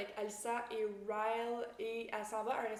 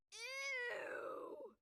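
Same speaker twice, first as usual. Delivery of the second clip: disgusted ew; 8 dB quieter than usual. Real, not acted. Reacting with disgust to story.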